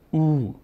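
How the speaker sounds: "او" held as a long oo; drawn-out vowel. Shown as a demonstration. Teacher showing the sound stretch, long-held "او". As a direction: stretch "او" slowly into oo.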